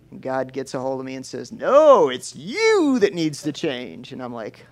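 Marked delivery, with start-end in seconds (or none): 1.5-4.1 a loud, firm "No", then slow and deliberate; God setting him straight, stretching each word; God correcting him. Retold or imitated.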